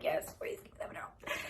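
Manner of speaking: breathless whisper through a contraction; strained whisper asking for relief; pained whisper. Retold or imitated. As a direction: barely voice it, hurting. Whispered mid-contraction with doctor present.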